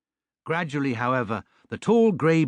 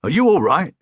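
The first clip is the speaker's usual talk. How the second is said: higher, louder anxious call; concerned cabbie calling down; 7 semitones higher, 6 dB louder than usual. Acted. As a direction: call out worried to the fallen bear. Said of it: "he called anxiously".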